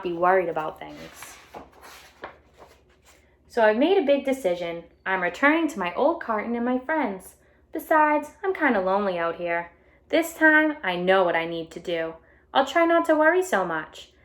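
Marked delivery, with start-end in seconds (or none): 3.5-14.1 bright storybook read-aloud voice; animated picture book reading; reading to children. A narrator's reading. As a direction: read it out to a class of kids.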